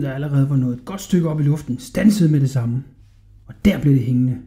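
ordinary delivery, nothing marked

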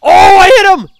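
sudden high, loud yelp of triumph; real thrilled cry; excited shout. Real, not acted. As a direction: shriek it like you just landed the hit. Real hit landed in game.

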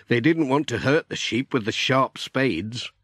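ordinary delivery, nothing marked

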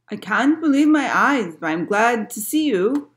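storybook castaway, loudly overjoyed; rescued man. A narrator's reading. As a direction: exclaim with relief at being rescued. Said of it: "said the man".

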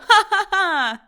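loud, high joyful laughter; big performed ha-ha-ha; hearty laugh. A narrator's reading. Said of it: "Це і справді був чудовий сміх".

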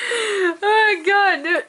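slow, high, helpless laughing groan; laughing hard. Real, not acted. Overcome by a funny video, laughing hard. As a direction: drag it out while cracking up.

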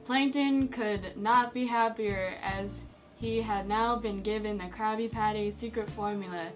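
ordinary delivery

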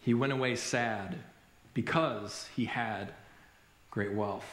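slow, low, soft and sorrowful; heartbreaking reading. A narrator's reading. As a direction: let each phrase sink with sadness. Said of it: "this next part is heartbreaking".

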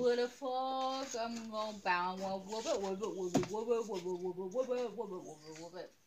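lightly voiced little frog. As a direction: chant it like a hopping frog. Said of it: "I'm trying to sound like a frog".

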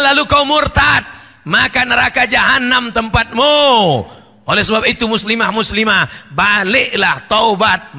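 loud, commanding sermon warning; preaching at full force. Acted. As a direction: thunder the warning at the women, then call them back to faith. Preaching warning against apostasy.

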